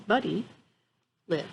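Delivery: plain reading voice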